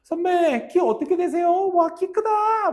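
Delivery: high-pitched, loud squeal of admiration; over-the-top fangirl impression; gushing junior students. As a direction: play starstruck juniors, last word drawn out. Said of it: "후배들이 막 와가지고".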